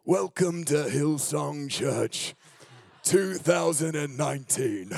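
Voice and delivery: slow, drawn-out Australian preacher greeting; Brian Houston impression. Imitating the Hillsong pastor's Australian welcome, slow. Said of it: "Have you ever heard Brian Houston preach at Hillsong?".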